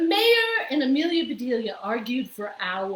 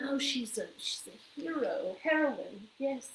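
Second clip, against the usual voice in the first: murmur; 6 dB quieter than usual. A narrator's reading. Crowd aside.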